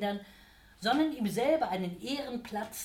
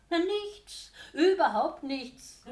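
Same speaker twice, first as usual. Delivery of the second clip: much higher, then louder and slow; mock innocence; 9 semitones higher, 7 dB louder, slower than usual. A narrator's reading. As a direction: play it falsely innocent. Devil's innocent protest. (gespielt unschuldig, hoch und gedehnt)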